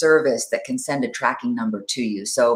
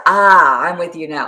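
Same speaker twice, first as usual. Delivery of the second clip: usual speaking voice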